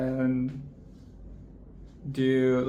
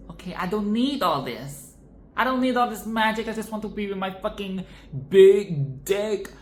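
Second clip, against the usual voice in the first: mock Fiona; 5 semitones higher than usual. Fiona tells Fairy Godmother she only wants her ogre, in a mock gushing voice. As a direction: ham up a lovestruck princess giving up her magic. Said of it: "she's like".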